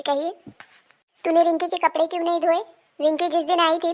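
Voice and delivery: ordinary talk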